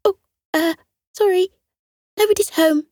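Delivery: high, timid, wobbly voice; nervous little cat hiding indoors. Acted. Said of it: "a little wobbly voice replied".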